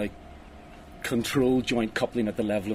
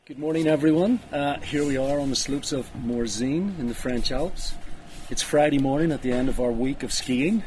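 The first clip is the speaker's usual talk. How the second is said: presenter intro, outdoors on the slopes; upbeat host greeting from the slopes; ski slope; 3 semitones higher, 4 dB louder than usual. Real, not acted.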